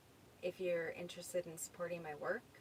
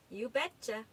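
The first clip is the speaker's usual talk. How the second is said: put-on Minnesota accent; 4 semitones higher, 8 dB louder than usual. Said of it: "You picked up a hint of a Minnesota accent".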